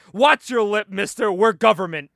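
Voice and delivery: lightly acted loud official rebuke; stern warning. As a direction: put them in their place like a mock government agent. Agent scolds back in retold quarrel.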